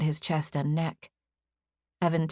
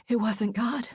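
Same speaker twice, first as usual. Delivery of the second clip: breathy voice; 6 semitones higher than usual. Acted. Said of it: "she said in a breathy voice".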